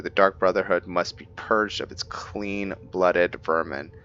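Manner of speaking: ordinary talk, nothing marked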